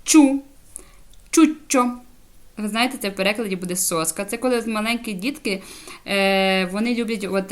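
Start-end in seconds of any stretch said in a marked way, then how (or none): 0.0-2.0 «чу» alone, then «чуччо», higher and louder; «чуччо» as a loud, high teaching example; ch plus u. Shown as a demonstration. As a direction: model «чу», pause, then «чуччо» with a clear ch. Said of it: "Тоді ви читаєте як".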